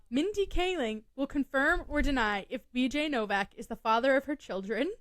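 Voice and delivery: usual speaking voice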